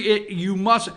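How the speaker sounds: usual speaking voice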